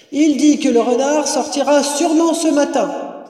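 high, projected mistress's voice; calling down. A narrator's reading. Mistress explains from atop the stairs, calling down.

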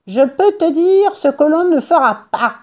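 high-pitched determined character; Bean's farmer voice. A narrator's reading. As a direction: answer firmly in a high character voice. Bean répond, voix haut perchée. (voix haut perchée, décidée)

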